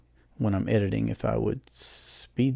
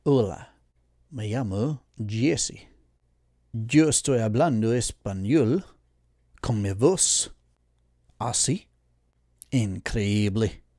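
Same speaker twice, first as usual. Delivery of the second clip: Spanish demo voice; 2 semitones lower than usual. Shown as a demonstration. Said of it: "like so".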